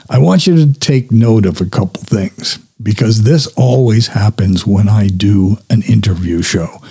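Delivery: usual speaking voice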